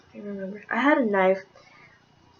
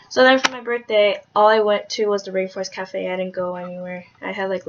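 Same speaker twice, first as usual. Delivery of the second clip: ordinary talk, nothing marked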